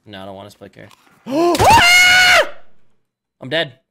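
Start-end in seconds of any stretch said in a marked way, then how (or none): 0.9-2.9 loud startled gasp; sharp shocked gasp on dying. Real, not acted. Sudden death in game.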